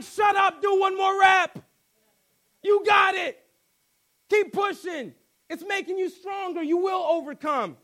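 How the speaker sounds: high, loud drill-them-on shouting; trainer's shout. Acted. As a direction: bellow encouragement like a hard-driving coach.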